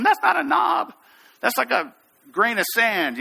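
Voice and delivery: high, protesting mock yell; retold yelling. Scared student yelling at the instructor, mock yell. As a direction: imitate a panicked climber shouting back in disbelief. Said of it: "they're yelling at me".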